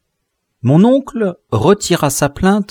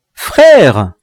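cried out; 2 semitones higher, 7 dB louder than usual. A narrator's reading. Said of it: "lui criai-je en entrant".

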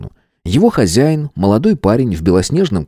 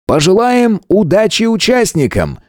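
higher, fake-cheerful announcement; ringmaster; 12 semitones higher than usual. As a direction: hype the crowd like a showman. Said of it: "объявил конферансье и делано".

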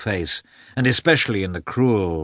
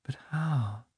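much softer, lost inner question; asked herself; 5 semitones higher, 9 dB quieter than usual. A narrator's reading. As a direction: ask it almost silently to yourself. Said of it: "she asked herself".

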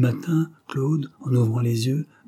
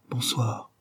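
"Bonsoir" softer and falling; soft reply; 5 dB quieter than usual. Acted. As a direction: breathe "Bonsoir" gently, trailing down. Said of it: "Elle répondit d'un souffle".

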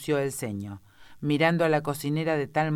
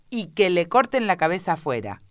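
loud, raised-pitch execution order; imperious queen's command; 5 semitones higher, 6 dB louder than usual. A narrator's reading. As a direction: pronounce the sentence loudly, as a queen.

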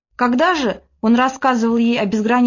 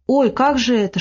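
usual speaking voice

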